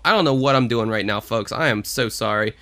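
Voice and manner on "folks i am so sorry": usual speaking voice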